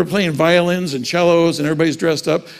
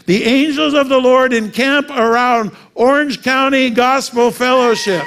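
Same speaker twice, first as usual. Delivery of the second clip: higher, louder, slow proclamation; preaching; 7 semitones higher, 5 dB louder, slower than usual. Shown as a demonstration. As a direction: proclaim the verse with full force, slowly. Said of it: "That's not just a nice little thought".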